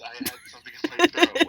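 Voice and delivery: very high, loud squawk of protest; indignant protest. Real, not acted. Game master insisting to a friend he really had something in his throat, high and loud. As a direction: yelp it in outraged self-defence. Defending against being called liar.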